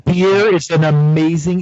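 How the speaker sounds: booming, close-miked voice; right up on the microphone; too close to the mic. Real, not acted. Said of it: "Mental is eating his microphone".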